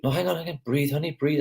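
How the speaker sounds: put-on calm your hurt child gently; mother soothing a toddler who fell.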